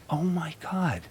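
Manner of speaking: hushed, awed thought; retold quiet shock; dawning realisation. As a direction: say it very softly to yourself. Retold thought as truth dawns.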